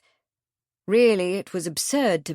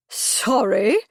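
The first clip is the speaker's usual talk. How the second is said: outraged disbelief; 3 semitones higher, 4 dB louder than usual. A narrator's reading. Outraged repetition.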